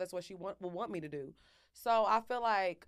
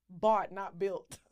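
slow quote; much slower than usual. Shown as a demonstration. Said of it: "What that girl say?".

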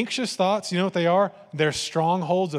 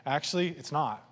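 ordinary delivery

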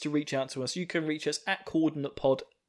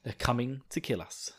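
fearful whisper; 2 semitones lower, 11 dB quieter than usual. Retold or imitated. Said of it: "on the floor saying".

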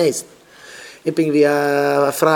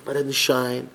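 ordinary talk, nothing marked

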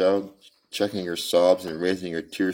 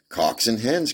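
put-on high, louder gleeful child cry; a delighted girl pointing at birds; 7 semitones higher, 5 dB louder, slower than usual. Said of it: "cried the little girl gleefully".

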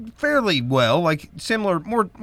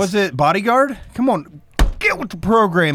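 thumping the table while talking; banging the desk; 6 semitones higher than usual. Real, not acted. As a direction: talk over desk bangs. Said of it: "Quit hit-, quit hitting my table".

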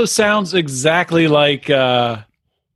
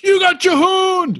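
mock shout; 14 semitones higher, slower than usual. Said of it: "someone comes in and goes".

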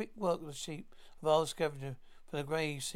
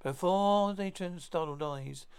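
ordinary delivery, nothing marked

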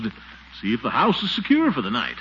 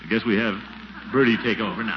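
normal talking voice

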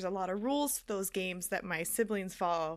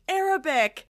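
retold yelled guess; raised, louder blurted guess; yelling; 5 semitones higher, 7 dB louder than usual. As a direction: call out a guess in a game. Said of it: "So I started yelling".

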